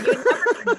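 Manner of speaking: high squeaky put-on voice with a laugh; mock husband voice. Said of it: "He's like".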